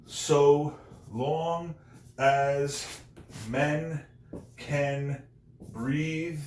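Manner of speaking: each word held out alone, with long pauses between; syllable by syllable. Shown as a demonstration. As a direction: count out "So long as men can breathe" one slow, separate syllable at a time. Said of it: "Ten syllables".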